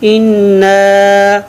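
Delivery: इन्ना slowed with the न्न held; doubled nun stressed. Shown as a demonstration. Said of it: "नून ज़बर अलिफ ना".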